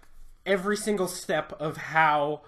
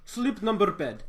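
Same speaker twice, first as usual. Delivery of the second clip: higher, excited Russian-ish pitch; hammed-up Russian sales pitch; mock ad voice; 5 semitones higher, 3 dB louder than usual. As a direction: hype the bed like an over-the-top ad. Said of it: "I was going".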